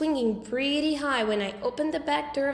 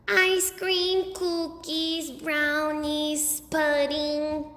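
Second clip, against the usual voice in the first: put-on higher, eager kid listing treats; little boy voice; 4 semitones higher, much slower than usual. A narrator's reading. Fudge reciting treats to Mom, eager high kid voice. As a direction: chirp the list like a greedy toddler.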